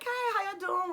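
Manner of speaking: high, sing-out friendly hello; kind, social greeting. Retold or imitated. Two men greeting her back, high and friendly. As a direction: imitate their sociable greeting, pitched high. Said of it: "kind and, and social as well".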